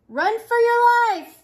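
high, loud mock-panic shout; panicked cry. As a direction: cry out a warning to the whole town. Panicked cry in a picture book.

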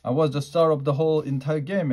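ordinary delivery, nothing marked

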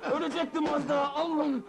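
high-pitched, fast angry tirade; furious scolding. Acted. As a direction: lash out in rapid, rising rage. Boss scolds and beats his man.

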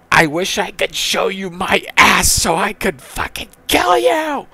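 high, rising defiant rant; angry last words. Acted. Grandson's last words to his grandfather, high angry rant. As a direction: rant upward into a squeal on the final word.

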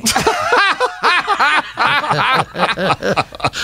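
a high, bursting laugh at his own joke; hearty laugh. Real, not acted. Guest telling the wife story, bursting into high laughter. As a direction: let the laugh break out high.

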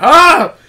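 sudden loud high shriek; scream. Pretend. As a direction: shriek in shock. Finding organs, shocked surgeon.

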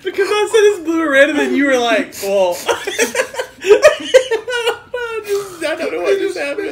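high, loud laughing through words; can barely speak for laughing. Real, not acted. Laughing fit after spill.

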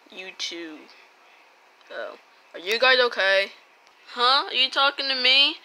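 ordinary delivery

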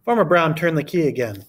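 plain reading voice

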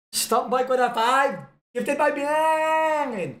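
loud, high, stretched shout of a gift alert; announcer yell for a gift; drawn-out alert shout. Acted. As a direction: yell the last words out long and high. Reading a gift alert aloud.

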